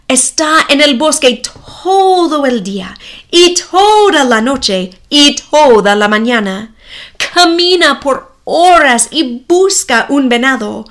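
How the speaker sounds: plain reading voice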